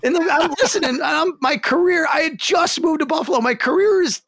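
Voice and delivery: high-pitched, worked-up disbelief; comic panic. Real, not acted. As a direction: wail it half laughing as your career goes down. Comic horror at career ending.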